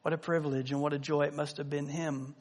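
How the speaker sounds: ordinary talk, nothing marked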